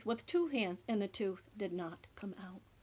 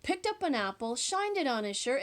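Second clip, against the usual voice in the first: usual speaking voice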